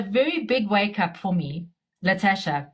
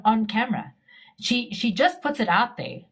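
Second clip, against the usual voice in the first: ordinary delivery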